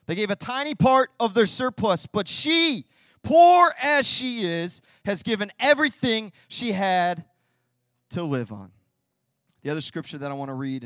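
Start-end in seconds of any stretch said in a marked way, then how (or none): 0.1-8.7 high and loud emphasis; emphatic preaching. Acted. Preacher repeating the verse to the church, loudly. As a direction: drive the point home.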